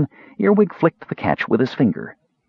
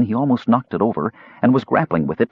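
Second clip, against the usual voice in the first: ordinary delivery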